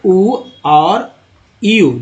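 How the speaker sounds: उ drawn out slowly; slow vowel demo. Shown as a demonstration. Teacher listing vowel sounds for students, slow and drawn out. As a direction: hold the उ long for the learners. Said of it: "जैसे अ, आ".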